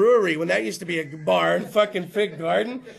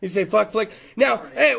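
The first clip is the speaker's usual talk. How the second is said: ordinary talk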